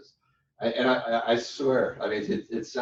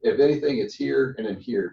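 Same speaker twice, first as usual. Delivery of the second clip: usual speaking voice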